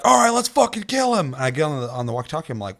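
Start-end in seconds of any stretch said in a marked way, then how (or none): 0.0-1.3 high, loud imitation of a hyped-up player; pumped up. Player rallying the team against Jason, pumped up. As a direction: mimic him raring for a fight. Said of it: "That guy was so fucking pumped".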